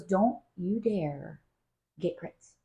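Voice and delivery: slow, low, soft don't-you-dare; mock warning. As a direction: drag it out like a stern warning to mom. Daughter warns against buying grits.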